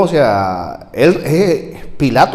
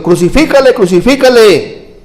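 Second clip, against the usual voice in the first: crowd shouting; 9 semitones higher, 6 dB louder than usual. Retold or imitated. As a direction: shout it like an angry mob. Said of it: "dieron voces diciendo". (gritado como una turba enfurecida)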